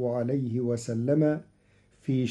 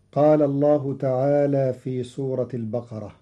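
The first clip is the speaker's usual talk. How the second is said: ordinary talk, nothing marked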